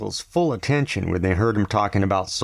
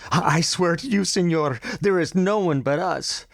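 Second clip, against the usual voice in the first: high, stammering plea; frightened pleading; 9 semitones higher than usual. A narrator's reading. Nadia pleading with the captain, terrified. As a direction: beg him to believe you. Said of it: "Nadia was pleading now".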